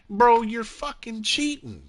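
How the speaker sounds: high-pitched exasperated accusation; mock accusation. Acted accusation of cheater.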